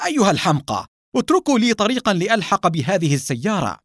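high, angry call; shouted demand. A narrator's reading. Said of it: "صارخا". (صراخ غاضب بنبرة مرتفعة)

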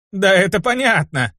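annoyed, brushing it off; irritated satyr. Acted. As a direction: wave it off with irritation. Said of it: "Раздражённо отмахнулся он".

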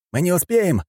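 high-pitched alarmed shout; crying out. A narrator's reading. Fighter realises time is short, crying out. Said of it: "крикнул Фанеров".

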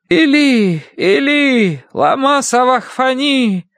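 very high, drawn-out wail to God; crying out. A narrator's reading. Woman reading Jesus' cry from the cross, high wailing declamation. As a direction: call out the holy words far above your voice. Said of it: "возопил Иисус громким голосом".

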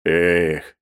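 a weary, drawn-out "ekh"; heavy sigh. A narrator's reading. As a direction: sigh it out in resignation. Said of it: "тяжело вздохнул Мозов". (с тяжёлым вздохом)

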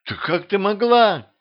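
high-pitched reproach. A narrator's reading. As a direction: pitch jumping up, appalled. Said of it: "Повысила голос королева".

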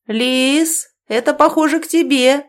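high, loud call through the flat; yelling. A narrator's reading. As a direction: shout it across the apartment. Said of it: "орёт на всю квартиру".

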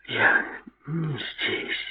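soft, drawn-out tipsy whisper. A narrator's reading. Drunk Linda murmurs to her lover that she's not here, whispering. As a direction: whisper it very softly. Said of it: "прошептала она".

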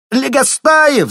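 full-throated shout down the corridor. A narrator's reading. Teacher shouting after a boy, loud and high. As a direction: yell his name high and loud. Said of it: "закричала из коридора Казакова".

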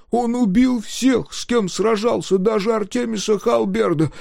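put-on high, loud frightened child; frightened boy. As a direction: warn him like a scared kid. Dozer warns coach.

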